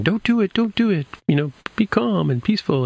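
retold high-pitched plea; pleading Stephen. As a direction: beg him to stop, voice jumped way up. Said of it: "and Stephen's saying".

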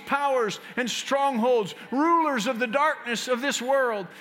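loud, high, forceful intercession; fervent prayer. Acted. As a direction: pray with full authority over the congregation. Opening prayer at full force.

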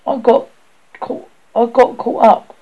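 put-on a boy tangled in creepers, calling out; lightly voiced fat boy, calling for help; lightly voiced stuck boy.

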